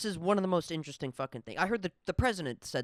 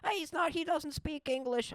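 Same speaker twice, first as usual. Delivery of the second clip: much higher, hammed-up complaining fan; mocking MAGA voice; 11 semitones higher than usual. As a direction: pitch it way up and whine the objection. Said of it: "They don't know anything".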